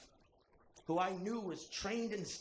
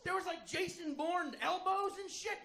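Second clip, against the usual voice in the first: excited; 12 semitones higher than usual. Real, not acted. Excited fight retelling.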